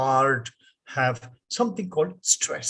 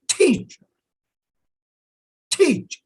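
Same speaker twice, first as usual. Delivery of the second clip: "teacher" with TEA much higher and louder; stress on TEA; 12 semitones higher, 5 dB louder than usual. Shown as a demonstration. Teacher showing the class English stress, first part raised. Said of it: "how do we pronounce these words? Listen".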